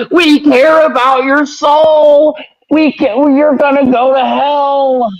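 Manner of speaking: high, slow, syrupy preacher voice; mock pious preacher. Mocking the pious crowd in a high preacher voice. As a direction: lay it on like a fake-caring televangelist. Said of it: "this whole pious presentation of".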